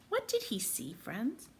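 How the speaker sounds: ordinary delivery, nothing marked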